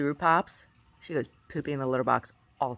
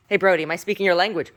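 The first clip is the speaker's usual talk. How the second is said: calling the dog; 5 semitones higher, 10 dB louder, faster than usual. Real, not acted. Mom calls to her dog.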